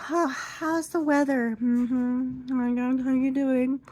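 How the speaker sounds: high, breezy small-talk voice; bubbly beautician imitation; chatty beautician. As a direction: mimic a cheery salon lady making chit-chat. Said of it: "she's like".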